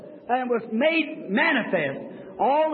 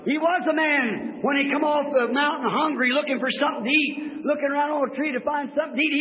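normal talking voice

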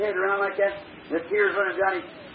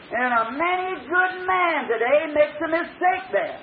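fiery preaching; 4 semitones higher than usual. Acted. The preacher warns his congregation about paid prophets, fiery and raised. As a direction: preach it out to the crowd.

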